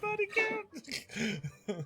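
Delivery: long, high, wailing cry; drawn-out mock wail; dramatic wail. Dramatic echo of body count.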